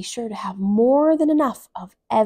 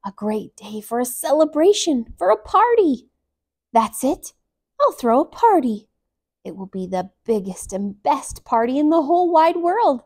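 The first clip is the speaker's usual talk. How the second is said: higher, louder and thrilled; excited elephant; 5 semitones higher, 6 dB louder than usual. A narrator's reading. Emmy planning a party for her friends, high and thrilled.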